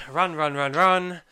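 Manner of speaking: loud, rising urgent call; hurried call to flee. Retold or imitated. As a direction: push the words like a chase. Narrating the dash to the rocket.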